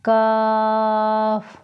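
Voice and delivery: काफ़ with a thin front k; light kaf. Shown as a demonstration. Teacher repeats the light काफ़ in contrast. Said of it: "दोनों में फ़र्क महसूस करें".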